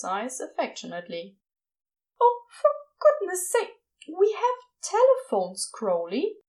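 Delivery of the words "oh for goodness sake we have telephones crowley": high fussy exasperation; prim and exasperated, pitched up; Aziraphale voice. Acted.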